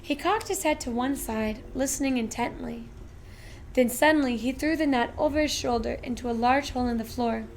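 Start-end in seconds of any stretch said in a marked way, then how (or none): none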